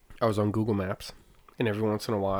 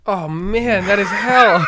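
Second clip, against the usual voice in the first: loud; 9 semitones higher, 10 dB louder, slower than usual. Real, not acted. Hot sauce toothbrush prank idea.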